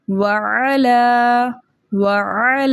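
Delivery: وعلی chanted slowly, ع from the middle of the throat, short vowels not stretched; tajweed recitation. Shown as a demonstration. Teacher shows students how to recite وعلی. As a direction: say وعلی slowly with a clean, unstretched ع. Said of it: "کیسے پڑھیں گے؟".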